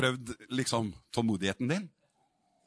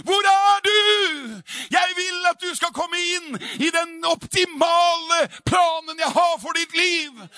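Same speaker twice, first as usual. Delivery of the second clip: God shouting down from heaven, very high and loud; sore, heartfelt cry; 12 dB louder, slower than usual. Acted. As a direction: cry out with all your longing. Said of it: "hører du ropet, det såre, inderlige ropet".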